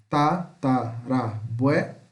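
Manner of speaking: ta - ta - ra - bue - lo, spaced out; slow, chopped-up Tatarabuelo; syllable separation. Shown as a demonstration. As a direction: pause after each syllable of Tatarabuelo. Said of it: "separação de sílaba".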